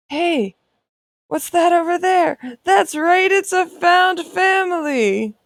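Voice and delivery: high, excited host voice building to a slow shout; game-show reveal. Acted. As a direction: gasp at the surprise, then shout it out.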